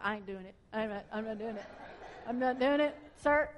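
mumbled defiant refusal to God; stubborn refusal. Retold or imitated. Stubbornly refusing God's command.

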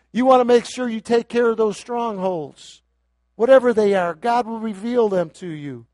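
fervent sermon voice; preaching. Real, not acted. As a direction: charge the people to be strong. Preaching at full force.

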